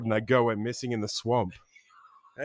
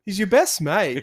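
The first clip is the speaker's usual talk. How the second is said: higher, louder needling jibe; mock teasing; 7 semitones higher, 5 dB louder than usual. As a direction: imitate winding up a raging mate. Teasing reply acted to ranting mate.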